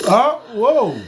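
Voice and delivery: loud startled huh; shocked. Real, not acted. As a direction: caught off guard, gasp it out. Shocked reaction to confession.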